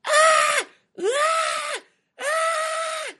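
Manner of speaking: very high-pitched cartoon scream; three shrieks, scared out of your wits; high squealing screams, fading in volume; terrified scream. A narrator's reading. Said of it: "Lyle screamed".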